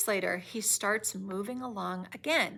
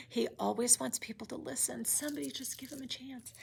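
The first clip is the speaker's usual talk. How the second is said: unvoiced, much softer aside; whisper; 8 dB quieter, faster than usual. Real, not acted. Teacher pleading for the creature, whispered.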